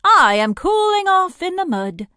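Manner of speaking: high, loud storybook hippo voice; hippopotamus character. A narrator's reading. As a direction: pitch it way up as a hippo. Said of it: "said the hippopotamus".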